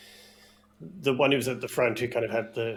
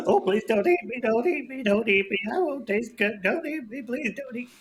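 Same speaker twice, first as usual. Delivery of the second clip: much higher, louder, frantic pleading; panicked begging; 11 semitones higher, 5 dB louder than usual. Acted. Terrified captive pleading with the snake not to eat him, high and frantic. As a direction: beg for your life in full panic. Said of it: "starts going; full-on panic".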